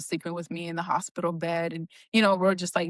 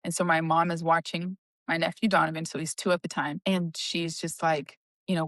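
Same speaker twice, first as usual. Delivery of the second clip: ordinary delivery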